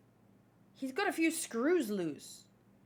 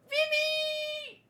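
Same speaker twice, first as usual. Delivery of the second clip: excited shout. Real, not acted. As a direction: shout her name in pure fan joy. Excited fan shouts character's name.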